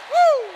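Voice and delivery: sudden high joyful "woo"; high ecstatic whoop. Real, not acted. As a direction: let out a high whoop of praise. Preacher's ecstatic cry.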